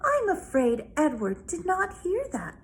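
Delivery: put-on a small girl politely insisting to her father; child speaking up for her toy rabbit; little girl voice. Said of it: "Abilene would say".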